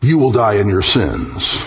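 "you will die in your sins" landed low after a held pause for emphasis; after a dramatic pause. Acted. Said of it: "pause for emphasis".

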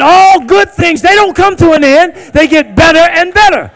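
loud high preached shout; shouted sermon climax; full-force preaching. Shown as a demonstration. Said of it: "I said".

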